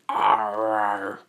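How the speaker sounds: drawn-out, sustained Titan word; Titan mouth voice. Acted. Izuku repeats it through the Titan's mouth, drawn-out creature voice. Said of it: "Izuku say it again".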